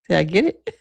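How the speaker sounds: ordinary delivery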